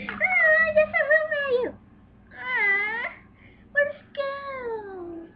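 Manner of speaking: high, loud doll voice; hammed-up, high-pitched wail; play-acted outcry. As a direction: cry out in a high pretend voice. Doll woken up calls out.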